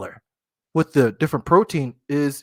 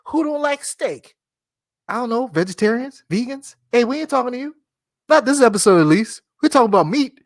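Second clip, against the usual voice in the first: playful high teasing; 8 semitones higher than usual. Real, not acted. Teasing vegetarians.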